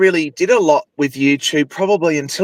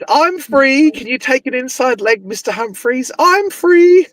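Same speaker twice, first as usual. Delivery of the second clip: high, sing-song camp sitcom catchphrase; Mister Humphreys; 10 semitones higher than usual. Acted. Fan quoting a sitcom salesman's catchphrase to a friend, high camp impression. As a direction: pitch it way up and call out the catchphrase.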